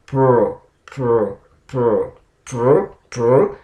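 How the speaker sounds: pr repeated crisply; consonant blend demo. Shown as a demonstration. Teacher drilling the pr blend for learners. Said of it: "P R ... consonant blending".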